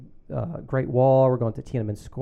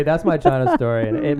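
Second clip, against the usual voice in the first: ordinary talk, nothing marked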